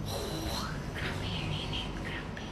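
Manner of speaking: whispered complaint about the heat; whispering. Real, not acted. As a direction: breathe it out in a whisper. Repeats the heat complaint unvoiced.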